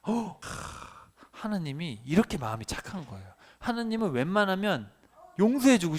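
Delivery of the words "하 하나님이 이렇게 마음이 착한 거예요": ordinary talk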